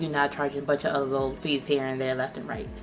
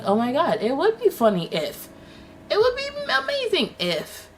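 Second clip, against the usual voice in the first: high, sing-song fake innocence; coy mock hinting; 14 semitones higher, 4 dB louder than usual. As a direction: play dumb in a high sweet voice. Mocking sellers' coy hints.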